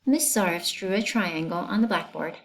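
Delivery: ordinary delivery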